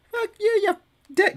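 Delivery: high, strained mock yell; imitated rant yell. Host quotes a famous rant clip, high mock yelling. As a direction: mimic a meme outburst without full volume. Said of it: "that rant where he yells out".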